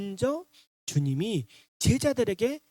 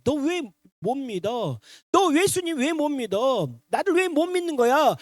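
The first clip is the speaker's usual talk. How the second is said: raised, louder reproach building up; rebuking; 6 semitones higher, 5 dB louder than usual. Retold or imitated. Imagined Jesus reproaching doubting disciples, rebuking. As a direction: scold them for their disbelief. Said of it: "라고 예수님이 책망하신 게 아니라는 거예요".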